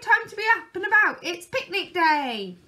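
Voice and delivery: very high, loud urgent call; loud shout. A narrator's reading. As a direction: shout through the door to wake him. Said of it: "he cried".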